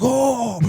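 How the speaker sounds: loud, high panicked "oh"; high hammed-up holler; mock panicked yell. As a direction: imitate a man freaking out in a high cry. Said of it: "he's, like, panicking".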